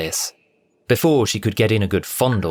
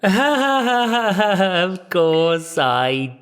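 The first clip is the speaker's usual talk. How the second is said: very slow, strained fake laughter; forced laugh; 9 semitones higher, much slower than usual. A narrator's reading. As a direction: laugh through gritted teeth. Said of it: "Cassandra forced a laugh".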